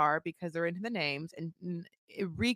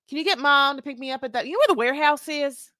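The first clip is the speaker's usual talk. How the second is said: higher, louder Southern phone voice; Southern accent; 5 semitones higher, 6 dB louder, faster than usual. Retold or imitated. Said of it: "I'm giving a real Southern accent".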